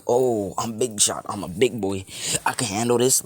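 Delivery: loud, high mock bragging; exaggerated big-boy swagger, mimicking him; mocking boast. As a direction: puff it up like a cocky kid. Mocks the injured boy's boasting.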